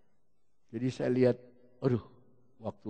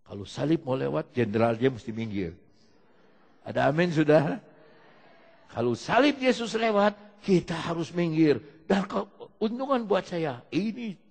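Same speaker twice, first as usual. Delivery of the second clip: louder, rising preacher's call; preaching at full force; 3 semitones higher, 10 dB louder than usual. Acted. Preacher rousing the congregation about the cross, loud preaching. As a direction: drive it home to the congregation.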